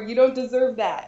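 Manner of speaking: normal talking voice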